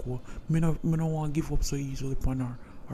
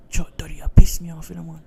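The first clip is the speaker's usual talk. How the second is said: angry outburst; 4 semitones higher, 10 dB louder than usual. Acted. Son angry at father.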